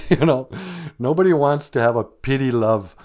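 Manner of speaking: ordinary talk, nothing marked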